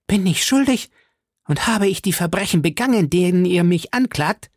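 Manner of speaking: high, frantic protest without full volume; screeching. A narrator's reading. Milady challenges her accusers, screeching in a high voice. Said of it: "kreischte Milady".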